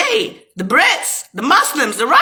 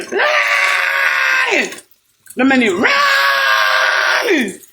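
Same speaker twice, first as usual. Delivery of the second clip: high, slow, drawn-out wailing shout; wailing cry; 12 semitones higher, much slower than usual. Real, not acted. Ranting at the bay, crying out the words in a high wail. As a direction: stretch each word out in a high wail.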